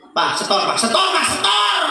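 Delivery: rising, insistent demand; wife demanding the day's earnings; nagging wife. Retold or imitated. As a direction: pester him for the money.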